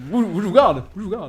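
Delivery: high-pitched, mannered caricature; hammed-up impression, voice pitched high; Henri de Lesquen. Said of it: "Henri de Lesquen".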